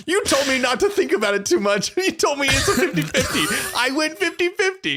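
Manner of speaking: teasing laugh while gloating; laughing protest. Real, not acted. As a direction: giggle through the triumphant complaint. Laughing protest after winning.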